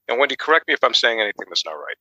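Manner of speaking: ordinary delivery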